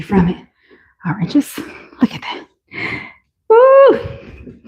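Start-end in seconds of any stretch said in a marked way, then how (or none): 1.1-2.5 soft whisper to herself; hushed, admiring whisper. Real, not acted. Hushed aside while showing shoe. 3.5-4.6 high, loud delighted woo; squealing woo of delight; excited whoop. Real, not acted. Admiring shoes on camera.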